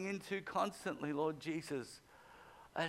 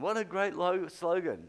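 normal talking voice

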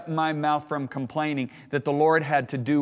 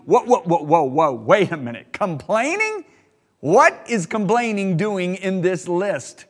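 louder stammered what what, whoa, wait a minute; mock surprise; 3 semitones higher, 5 dB louder than usual. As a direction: act thrown by the word complaining.

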